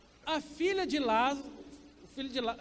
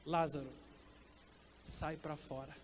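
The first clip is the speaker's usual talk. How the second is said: much lower and softer, a hushed calm call to the dead man; quiet command; 9 semitones lower, 10 dB quieter than usual. Retold or imitated. Preacher voicing Jesus at the tomb, low and hushed. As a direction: say it low and soft, barely above a murmur. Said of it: "e ele fala assim".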